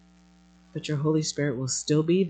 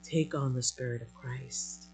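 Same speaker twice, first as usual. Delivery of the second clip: softer, lower, tender reassurance; tender voice of God; 4 semitones lower, 7 dB quieter than usual. Acted. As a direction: gently soothe a dear child as God. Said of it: "you just simply are saying to us".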